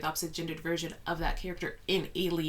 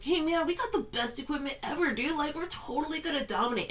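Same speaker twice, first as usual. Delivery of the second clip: pitched way up, cocky bro bravado; cocky high-strung guy; 10 semitones higher than usual. Retold or imitated. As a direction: ham up an overconfident marine. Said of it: "the character who's really high-strung".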